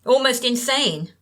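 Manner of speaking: ordinary delivery, nothing marked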